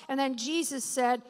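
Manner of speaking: ordinary talk, nothing marked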